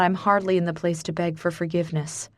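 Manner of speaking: plain reading voice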